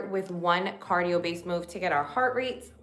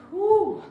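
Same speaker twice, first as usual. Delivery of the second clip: loud high whoo; high cheer; 13 semitones higher, 7 dB louder than usual. Real, not acted. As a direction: push through the last rep. Counting lunges.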